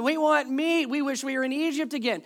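high, loud grumbling demand; mock complaining. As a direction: clamour like an ungrateful crowd. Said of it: "They kept complaining".

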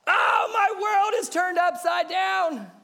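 high, loud, slow wail of despair; hammed-up cry of a tiny grain whose world flipped; melodramatic grain of sand. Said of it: "One more time".

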